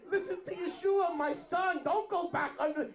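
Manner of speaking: high-pitched booming-out mock divine call; voice from heaven. God warning Kifa from heaven, high hammed-up voice. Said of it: "comes out of heaven in a voice and says".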